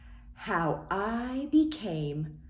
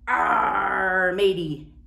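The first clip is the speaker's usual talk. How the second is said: pirate voice; 6 dB louder, much slower than usual. Pretend. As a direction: growl it like a pirate. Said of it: "a pirate says".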